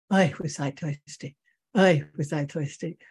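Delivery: put-on Yiddish-accented old man's lament. Old man on the train after drinking, still complaining in Yiddish accent. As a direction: keep kvetching in a Yiddish accent. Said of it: "he talks in a Yiddish accent".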